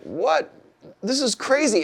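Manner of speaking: high-pitched retold disbelief; mock astonishment. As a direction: act out being amazed, pitch jumping up. Retells being stunned by bus crowd.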